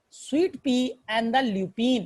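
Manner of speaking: slow, word by word; sweet पी drawn out slowly; slow dictation. Shown as a demonstration. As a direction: spell it out for the class. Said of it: "teacher dictating plant names".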